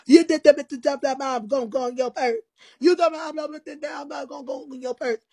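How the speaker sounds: high, loud mocking rant; mocking Cammy's accusation. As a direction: imitate her nagging accusation with a sneer. Quoting Cammy's angry accusation.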